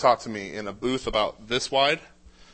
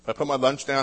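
usual speaking voice

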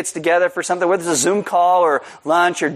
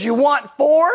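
threatening mom; 9 semitones higher than usual. Retold or imitated.

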